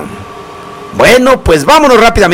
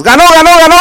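excited win call; 9 semitones higher, much faster than usual. Real, not acted. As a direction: celebrate the winner like a goal call. DJ announces the winning song.

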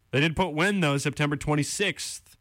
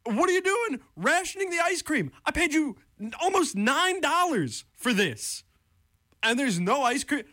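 [name] style rant; 12 semitones higher than usual. Retold or imitated. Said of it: "it's like the [name] bit".

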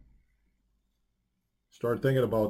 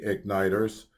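normal talking voice

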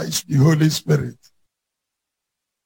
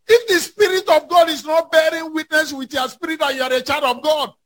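fiery preaching; 7 semitones higher than usual. Acted. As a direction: preach it hard to the congregation. Preaching at full force.

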